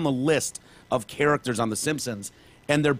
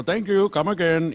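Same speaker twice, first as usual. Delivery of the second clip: Apu; 5 semitones higher, 3 dB louder than usual. Retold or imitated. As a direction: mimic a cartoon Indian-accented catchphrase. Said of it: "Apu ... He's so stereotypical ... so stereo".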